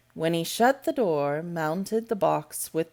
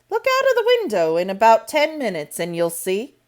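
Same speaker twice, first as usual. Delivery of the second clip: higher, louder, quick brusque answer; brisk woman; 4 semitones higher, 7 dB louder than usual. A narrator's reading. Medlock answering Mary, brisk and quick. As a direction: brush her off briskly.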